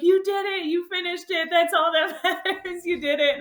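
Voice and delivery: high, loud consoling cheer breaking into a laugh; tearful cheer. Retold or imitated. Friend hugging the runner at the finish, high tearful cheer. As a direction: lift her up, laughing through tears. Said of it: "And she's like".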